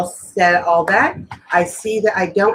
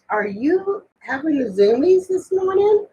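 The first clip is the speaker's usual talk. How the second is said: talking to the cat; 9 semitones higher than usual. Real, not acted. Speaking to the cat.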